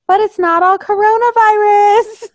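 high, loud, whiny mock of a denier. Mocking the denier with a high whine. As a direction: squeal it like a petulant skeptic. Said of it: "And he's like".

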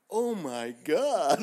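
slow, high, very loud exclamation; mock horrified shout. Medic imitating his driver seeing the injury, horrified shout. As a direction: drag out the shock at full volume, hammed up. Acts out driver's shocked reaction.

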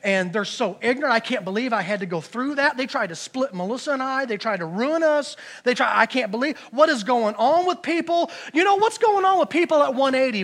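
loud, heated complaining to God; mock self-righteous outburst at God; acted angry rant. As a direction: vent like a fed-up, resentful believer. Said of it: "how quickly we go from praising the Lord to anger".

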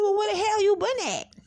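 high, loud indignant question; retold scolding. Asking girlfriend where she has been, indignant.

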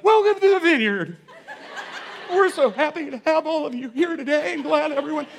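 high, loud, laughing forced cheer; nervous high welcome. Retold or imitated. Pastor welcoming church while gunman sits, high loud nervous cheer. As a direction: fake confidence with rising panic. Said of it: "I wish I could say I was bold".

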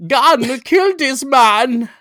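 loud, high, drawn-out cartoon German; over-the-top German accent, slow and high; exaggerated German accent. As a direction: belt it out in a hammed-up German accent. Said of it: "Otherwise, I would have said".

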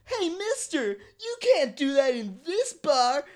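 very high slow whine; whiny cartoon voice. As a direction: whine at the customer.

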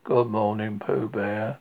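Eeyore's glum good morning; gloomy. Acted. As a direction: answer the hello with no joy at all. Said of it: "said Eeyore gloomily".

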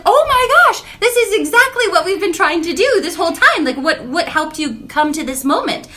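high, loud, thrilled teacher voice; squealing with joy, settling by the end; excited gush. Retold or imitated. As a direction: burst out delighted at her student's breakthrough. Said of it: "And I was like".

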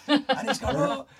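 high, loud and laughing through the words; can barely get it out for laughing; telling a funny story while cracking up. Real, not acted. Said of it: "Oh, they always are".

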